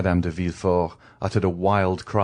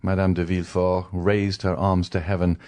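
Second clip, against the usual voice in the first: usual speaking voice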